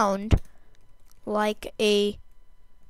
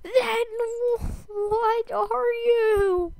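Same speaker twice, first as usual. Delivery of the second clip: high, cracking panicked question; 11 semitones higher, 3 dB quieter, slower than usual. Real, not acted. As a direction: squeak it out in alarm.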